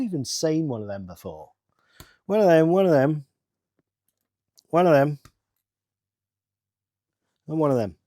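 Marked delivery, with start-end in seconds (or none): 2.3-8.0 loud, eager repetition; excited. Real, not acted. Builder delighted by a piece never seen before, excited. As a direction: chant it loudly with glee.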